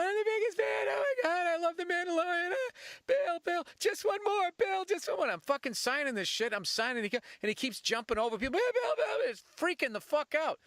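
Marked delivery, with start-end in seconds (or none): 0.0-5.3 squeaky excited pleading fan; high-pitched kid. Retold or imitated. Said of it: "high-pitched voice". 8.5-9.3 squeaky excited pleading fan; high-pitched kid. Retold or imitated. Kid calling for attention.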